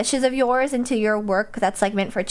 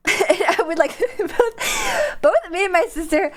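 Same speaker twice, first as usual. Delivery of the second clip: helpless high laughter between words; breaking up laughing; 5 semitones higher, slower than usual. Real, not acted. Streamer recalling crying as a kid with sister, laughing hard. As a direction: try to talk through a laughing fit. Laughing over childhood memory.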